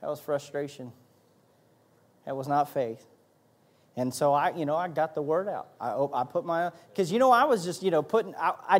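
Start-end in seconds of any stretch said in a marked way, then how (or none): 0.0-3.1 penitent prayer. Retold or imitated. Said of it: "And I said".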